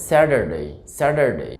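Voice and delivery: American স্যাটারডে as "SAD-er-day"; American flapped t in স্যাটারডে; American pronunciation. Shown as a demonstration. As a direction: say স্যাটারডে with t turned to d and r sounded. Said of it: "American উচ্চারণ হবে".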